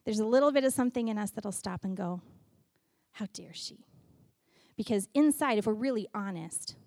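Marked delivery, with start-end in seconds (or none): 3.1-3.9 very soft, low indignant whisper; resentful whisper. Acted. A stuck sister silently judging, resentful whisper. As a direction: whisper it like a secret grudge.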